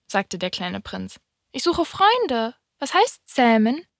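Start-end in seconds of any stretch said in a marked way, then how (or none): 1.5-3.9 high little prince voice, ending in a question; explain, then ask, pitched up as the prince. A narrator's reading. Little prince seeks friends and asks the fox, high-voiced.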